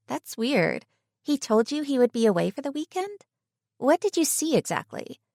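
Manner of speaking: high, puzzled friend voice. Acted. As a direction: probe for details. Voicing friend Tegan.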